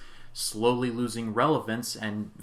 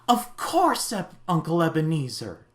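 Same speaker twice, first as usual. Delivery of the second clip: much higher, louder imitation of a delighted nephew; enthused nephew; 5 semitones higher, 4 dB louder than usual. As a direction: gush it out like he can't believe his uncle came. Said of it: "he's so enthused to see his uncle".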